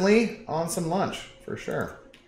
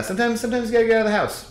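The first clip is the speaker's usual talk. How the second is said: mock hype; 12 semitones higher, 4 dB louder, much faster than usual. Exaggerated excuse, high and fast.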